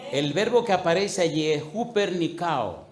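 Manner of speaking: ordinary talk, nothing marked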